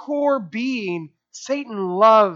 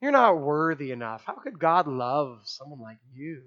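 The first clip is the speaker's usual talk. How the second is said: much softer, lower needling inner voice; hushed lower taunting whisper-like voice; soft accuser voice; 6 semitones lower, 6 dB quieter than usual. Retold or imitated. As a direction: breathe the doubt in quietly, like a voice inside. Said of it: "a little voice inside us says".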